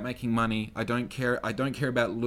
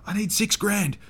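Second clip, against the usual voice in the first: loud, high, frantic pleading; high-pitched yell, begging and stumbling over words; yelling at Dad; 10 semitones higher, 6 dB louder than usual. Retold or imitated. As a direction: plead urgently with Dad at a raised pitch, building to a shout. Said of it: "I'm yelling at him".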